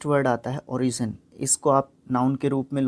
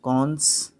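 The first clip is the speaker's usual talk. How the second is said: ordinary talk, nothing marked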